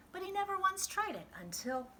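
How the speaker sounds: high, quick lead-in; quick lift. A narrator's reading. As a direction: rush up into the turn. Narration builds to the turn.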